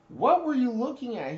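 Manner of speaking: high, loud demand; drill instructor yell. Retold or imitated. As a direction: bark the question at him. Drill instructor yelling at recruit.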